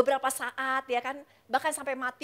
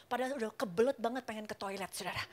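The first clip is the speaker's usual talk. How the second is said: ordinary talk, nothing marked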